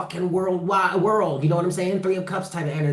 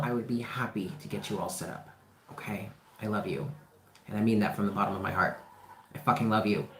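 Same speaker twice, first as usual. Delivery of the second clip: quiet tender; 5 semitones lower, 9 dB quieter, faster than usual. Real, not acted. Tender sign-off to viewers.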